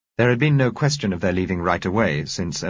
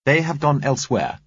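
higher, louder, sharp retort; 9 semitones higher, 5 dB louder than usual. A narrator's reading. Woman rebuffing a question about the others, sharp. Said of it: "she said sharply".

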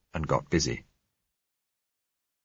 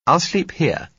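lighter, higher woman's voice; young woman voice; 10 semitones higher, 5 dB louder, slower than usual. Acted. Female character voiced by narrator.

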